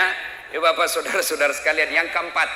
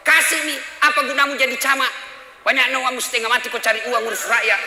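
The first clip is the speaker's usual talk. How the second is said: loud, higher scolding of a husband; angry wife; 8 semitones higher, 5 dB louder than usual. Retold or imitated. Wife scolding the camat husband over a phone, angry yell. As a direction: scold him hard for being stingy. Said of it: "Marah mamanya".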